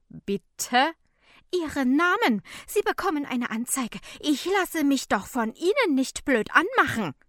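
loud, high, agitated tirade; outraged. A narrator's reading. Woman threatens to report helper, loud and outraged. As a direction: build from affronted question to furious threat.

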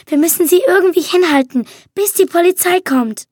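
ordinary delivery

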